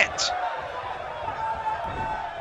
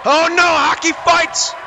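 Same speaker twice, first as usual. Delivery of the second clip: wrestling commentator; 8 semitones higher than usual. Acted. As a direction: call the near fall like ringside. Ringside call of a near fall.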